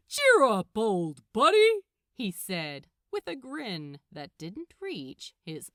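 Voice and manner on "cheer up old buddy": loud, hearty, falsely chummy; loud hollow cheer; faux friendly. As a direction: boom it out with a grin that doesn't reach the eyes. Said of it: "in a faux friendly manner".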